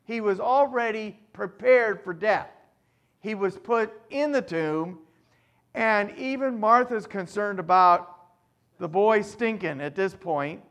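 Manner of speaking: ordinary talk, nothing marked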